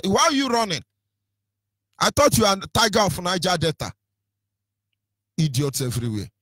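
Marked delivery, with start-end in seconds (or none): 0.0-3.9 sneering at a governor who fled; mocking taunt. Real, not acted. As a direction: taunt him for running away. Taunting a fleeing politician.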